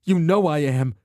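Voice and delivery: high, loud tearful insistence; tearful shout. Acted. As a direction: plead through tears. Alana, tearful and angry.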